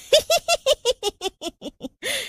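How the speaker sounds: high rising cackle; shrill witch cackle. A narrator's reading. Witch cackling as she orders them to dance. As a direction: cackle up into a shriek.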